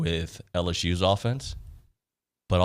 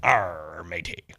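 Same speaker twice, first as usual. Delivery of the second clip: a lightly voiced cartoon pirate; 5 semitones lower, much slower than usual. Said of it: "Why did I do a pirate voice?".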